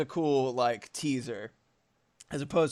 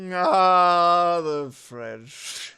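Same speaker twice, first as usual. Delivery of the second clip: big loud drawn-out sigh of a word, then dropping; hammed-up pompous drunk; drunk Orson Welles; 4 semitones higher, 8 dB louder, much slower than usual. Streamer acts out take three of the ad, drunk pompous impression. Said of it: "He's like".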